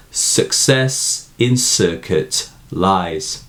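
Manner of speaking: Success, circuit read slowly with the S sounds drawn out; slow recited line. Shown as a demonstration. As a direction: lean on every S in Success and circuit. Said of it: "repetition of the S sound".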